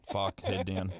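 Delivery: normal talking voice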